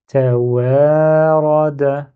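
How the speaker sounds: a slow demo of fluent reading; slow and fluid. Shown as a demonstration. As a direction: stretch Tawarada out with no break between letters. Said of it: "avec fluidité, mais lentement".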